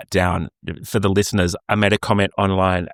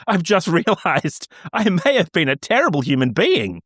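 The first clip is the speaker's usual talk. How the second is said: laughing; 7 semitones higher than usual. Real, not acted. Laughing at own pranks.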